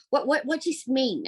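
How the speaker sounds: put-on a gentle, curious old woman asking; elderly lady.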